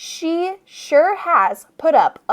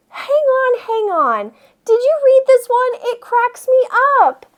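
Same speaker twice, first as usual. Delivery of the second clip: high, louder and delighted; a teacher eagerly sharing a joke book with a bored student; 11 semitones higher, 3 dB louder than usual. Acted. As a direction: push the joke book with glee.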